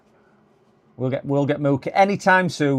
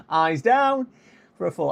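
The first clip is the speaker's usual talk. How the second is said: bingo caller; 5 semitones higher than usual. Pretend. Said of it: "bingo caller".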